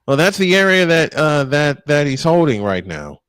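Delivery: ordinary talk, nothing marked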